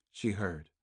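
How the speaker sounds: plain reading voice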